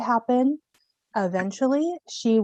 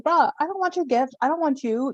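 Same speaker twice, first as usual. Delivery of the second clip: indignant rejection; 7 semitones higher than usual. Acted. Cassandra rejecting betraying Apollo.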